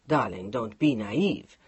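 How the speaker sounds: Mark, low and teasing; man's voice. A narrator's reading. Mark's voice.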